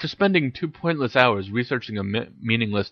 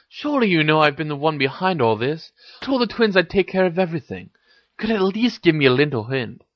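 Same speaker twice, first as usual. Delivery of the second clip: higher-pitched exasperated coaxing; 6 semitones higher than usual. A narrator's reading. Harry coaxes Hermione for a hint, exasperated. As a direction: coax with mild exasperation. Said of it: "said Harry with exasperation".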